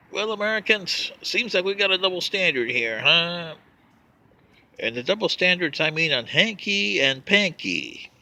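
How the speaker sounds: ordinary talk, nothing marked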